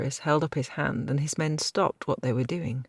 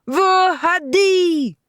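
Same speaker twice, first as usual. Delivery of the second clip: war scream; 8 dB louder, much slower than usual. A narrator's reading. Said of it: "screamed the Count".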